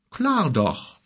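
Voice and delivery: high-pitched, casual confirmation; unruffled. Acted. Giant answering the astonished bear, unruffled. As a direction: confirm it as obvious, without fuss. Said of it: "sagte Gork ungerührt".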